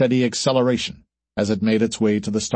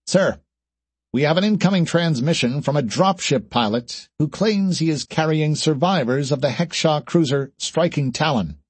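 higher-pitched junior officer's report; formal ship's report, pitched up; communications officer; 5 semitones higher than usual. Acted. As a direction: report to the captain in a raised voice.

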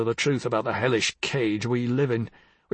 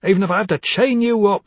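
ordinary talk

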